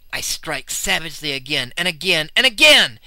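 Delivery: building loud frenzy; frenzied. A narrator's reading. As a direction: pound each again harder. Frenzied attack narration.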